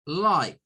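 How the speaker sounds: lie said with a clear ai; lie syllable. Shown as a demonstration. Teacher isolating the syllable lie for the class. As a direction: give lie a full ai sound. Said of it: "la L I G H se reduce a lie".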